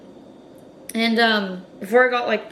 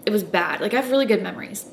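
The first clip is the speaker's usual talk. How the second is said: ordinary delivery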